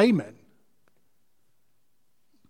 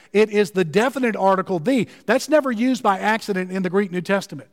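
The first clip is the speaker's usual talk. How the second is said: ordinary talk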